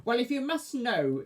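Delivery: high snappy witch; snapping witch. Acted. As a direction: snap it like a cross witch. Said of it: "she snapped".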